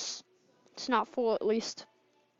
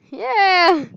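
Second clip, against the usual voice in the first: loud, high victory shout; triumphant yell; 7 semitones higher, 16 dB louder, much slower than usual. Real, not acted. Qualifying in the game, shouting in triumph. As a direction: yell it out, thrilled.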